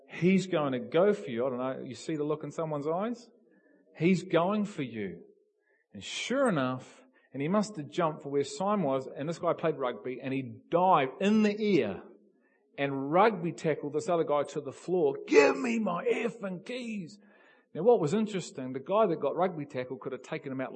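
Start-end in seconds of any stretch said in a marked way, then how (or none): 0.1-1.5 louder, urgent warning. Retold or imitated. Warning his colleague again as the manager charges, urgent and louder. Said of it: "I'm going". 4.0-5.2 urgent warning. Retold or imitated. Drunk man sprinting at them. 15.3-17.2 put-on high, slow, roaring drunk demand; drunken yell. Drunk area manager tackling the colleague, high slow furious yell.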